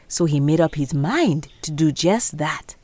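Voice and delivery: ordinary delivery, nothing marked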